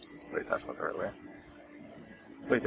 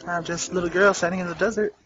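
put-on little girl voice; 7 semitones higher, 3 dB louder, faster than usual. Voicing the party's little girl.

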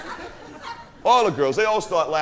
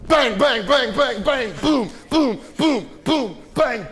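punch sound effects; 6 semitones higher, slower than usual. Retold or imitated. Frankie beating the biker, high punch noises. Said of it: "Frankie's like".

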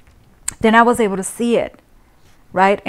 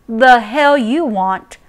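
slow, louder angry demand; 3 semitones higher, 7 dB louder, slower than usual. Acted. Mother facing the intruder, slow angry demand. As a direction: spit it at the intruder.

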